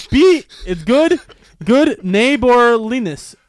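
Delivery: careful loud sounding out of a tricky word; slow careful sounding out. Shown as a demonstration. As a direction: spell out "good neighborliness" slowly and deliberately. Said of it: "sounding out the hard word".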